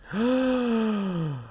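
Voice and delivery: big high gasp of shock; sharp gasp. Real, not acted. Cohost floors him with a theory, shocked gasp. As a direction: gasp like you just heard a revelation.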